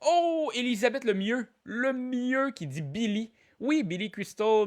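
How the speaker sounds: high, delighted-winner call; excited announcement. Real, not acted. Host announces a correct answer.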